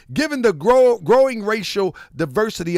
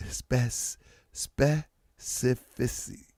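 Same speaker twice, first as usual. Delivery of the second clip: slow careful attempt; 6 semitones lower, 9 dB quieter, much slower than usual. Shown as a demonstration. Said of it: "I can't pronounce some of these European words".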